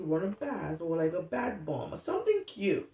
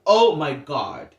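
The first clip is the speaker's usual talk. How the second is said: loud, slow groan of dread; loud, drawn-out oh my God; 11 dB louder, slower than usual. Retold or imitated. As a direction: drag it out in horror. Dread at the dentist news.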